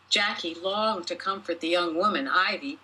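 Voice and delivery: ordinary delivery, nothing marked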